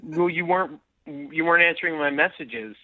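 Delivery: usual speaking voice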